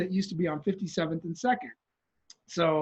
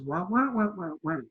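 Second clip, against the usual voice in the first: trumpet-like answer. Retold or imitated. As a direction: answer as a subdued muted trumpet. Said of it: "turns around and answers".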